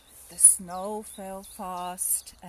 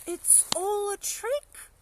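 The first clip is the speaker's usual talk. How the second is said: high, louder cry of disbelief; indignant child; 13 semitones higher, 5 dB louder than usual. Acted. Gruffalo's child declares the mouse is made up, indignant. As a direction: declare it a trick. Child decides it's a trick.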